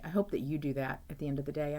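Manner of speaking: ordinary delivery, nothing marked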